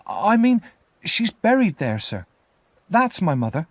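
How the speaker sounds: put-on high, urgent child's voice; anxious boy. A narrator's reading. Boy explains as the convict starts to run, anxious. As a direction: explain nervously as a little boy.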